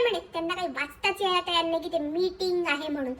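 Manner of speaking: normal talking voice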